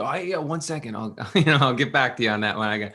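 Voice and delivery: fast evasive excuses; stalling nervously to avoid her. Retold or imitated. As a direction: rush through to dodge the question. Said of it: "So he tried to stall".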